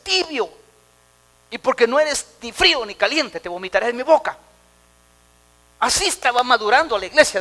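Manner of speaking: loud, high preaching at full force; fiery preaching. Acted. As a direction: preach it hard to the congregation.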